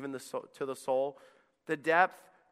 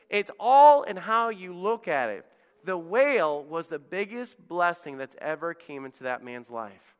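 normal talking voice

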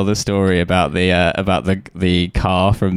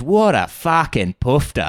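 put-on slow, higher broad Australian accent; Australian taxi driver; 5 semitones higher, slower than usual. Passenger mimicking the cabbie's verdict, slow broad Australian. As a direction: drag it out in exaggerated Australian. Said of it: "the most Australian man".

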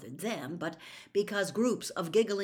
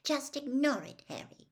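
Hermione voice; 9 semitones higher than usual. A narrator's reading.

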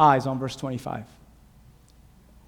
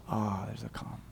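breathy whispered hush; 10 semitones lower, 17 dB quieter than usual. Real, not acted. Preacher whispering to the church about the calm, hushed. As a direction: breathe it out like stillness after the storm.

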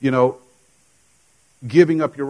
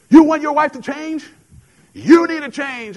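much higher, louder, slow pointed preaching; preacher on TV; 13 semitones higher, 8 dB louder than usual. Retold or imitated. TV preacher confronting the angry husband, high, loud and slow. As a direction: point at him and call him out. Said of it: "Creflo points to me and says".

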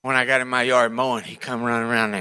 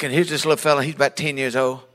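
ordinary talk, nothing marked

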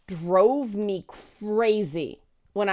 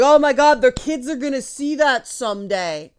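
louder, higher scandalized gasp; mock outraged parent; 4 semitones higher, 5 dB louder than usual. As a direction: play a pearl-clutching mom in a shocked voice.

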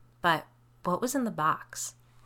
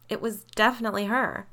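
emphatic, higher assertion; insistent; 4 semitones higher, 4 dB louder than usual. A narrator's reading. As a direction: stand your ground on it. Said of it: "I insisted".

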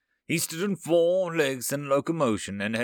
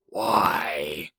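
low, forceful single-word demand; 7 semitones lower than usual. A narrator's reading. Alien counselor demanding the human's reason, forceful and low. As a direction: demand an answer, pitched right down. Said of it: "he demanded".

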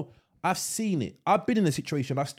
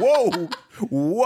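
mock alarm; 5 semitones higher, 8 dB louder than usual. Retelling his alarmed reaction, laughing.

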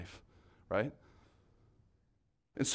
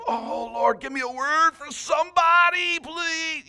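strained, pleading cry, pitched much higher and louder; mock desperate prayer; 13 semitones higher, 4 dB louder, slower than usual. Preacher mocking anxious prayer to the congregation, desperate high pleading cry. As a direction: ham up a believer begging God for a word. Sometimes we're like.

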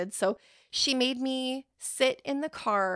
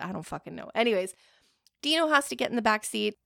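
ordinary talk

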